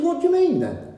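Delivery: high, surprised query; puzzled question. Retold or imitated. Retold own question, high pitch.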